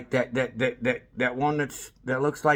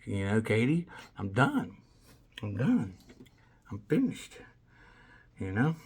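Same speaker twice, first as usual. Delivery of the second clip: weary quiet; 6 semitones lower, 7 dB quieter than usual. Real, not acted. Exhausted declaration of being done.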